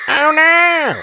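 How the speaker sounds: very high, loud, drawn-out wail; panicked cry. Real, not acted. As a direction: shriek it out in alarm, then let the pitch plunge. Player startled by the game.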